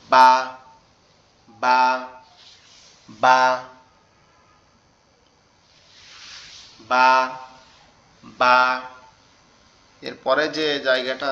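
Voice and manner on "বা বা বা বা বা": বা with both lips pressed together then released; demonstrated ba. Shown as a demonstration. Teacher shows students how to say বা, lips together. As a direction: pop বা off closed lips. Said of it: "লেগে গিয়ে উচ্চারণ হবে কিভাবে".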